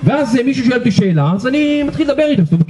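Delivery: usual speaking voice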